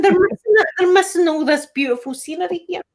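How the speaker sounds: high-pitched, incredulous exclamation; exclaiming in dismay. Retold or imitated. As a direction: re-enact it high, as if they can't believe it. Acting out their reaction on the bus.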